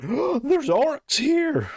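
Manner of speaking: high, slow, hammed-up cry of alarm; mock alarmed girl. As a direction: play a silly panicked villager, pitched well up. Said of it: "tells everyone".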